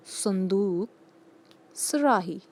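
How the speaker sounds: ordinary delivery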